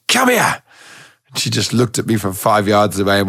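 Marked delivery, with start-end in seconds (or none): 0.1-0.6 high, sharp yell at the dog; exasperated high shout at the dog; shouted command. Retold or imitated. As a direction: bark the order to come, fed up. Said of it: "I was like".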